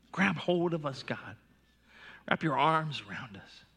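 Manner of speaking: pastor leading the congregation in prayer. Acted. Leads a prayer.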